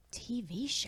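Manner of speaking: very soft imitated mumble; mumbling. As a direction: mumble it to yourself, imitating her. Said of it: "you hear her mumbling".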